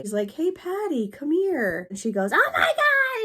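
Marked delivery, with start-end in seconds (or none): 0.3-1.9 high, sing-song calling out across the room; imitated calm call. Coworker calmly calls the floor nurse over, high sing-song call. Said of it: "she calmly tries to call". 2.3-3.2 high, loud panicked shriek; mock panic. Nurse finds the fainted supervisor, high loud panicked shriek. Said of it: "starts freaking out".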